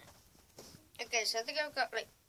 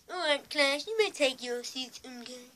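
higher-pitched cartoon school teacher voice; Mister Mackey; 4 semitones higher, 3 dB louder than usual. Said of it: "I think it's Mister Mackey".

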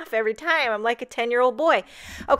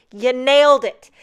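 You nailed it, punched loud with the stress on nailed; emphatic praise; 2 semitones higher, 10 dB louder than usual. Real, not acted. Crafter cheers the font designer, repeating it louder. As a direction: repeat it louder, really meaning it.

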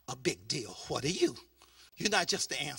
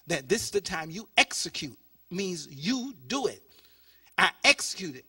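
ordinary talk

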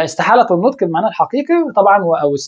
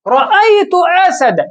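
exclaimed example; 9 semitones higher than usual. Shown as a demonstration. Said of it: "فتقول ماذا؟".

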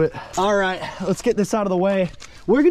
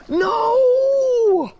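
dismayed cry; 13 semitones higher, 3 dB louder than usual. Real, not acted. Loses catch off the magnet.

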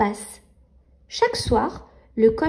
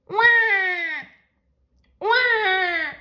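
put-on baby wail; 5 semitones higher than usual. Baby crying.

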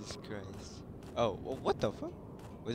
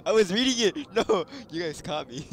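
high, loud, laughing as they talk; cracking up at being caught; real laughing protest; 9 semitones higher, 11 dB louder than usual. Real, not acted. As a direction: protest through a burst of laughter.

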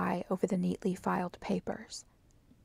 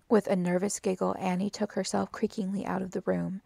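ordinary delivery, nothing marked

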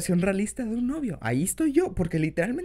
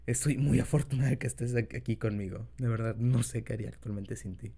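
put-on soft, low boyfriend voice; tender, shy lover, quiet; incubus; 6 semitones lower, 6 dB quieter than usual. Confession to partner in role.

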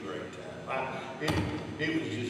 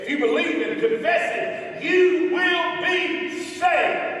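higher and louder, building as he goes; full-force preaching; 6 semitones higher, 8 dB louder than usual. Real, not acted. Preacher pleading with the congregation, building to a loud climax. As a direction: drive it home to the congregation.

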